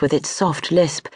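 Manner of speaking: usual speaking voice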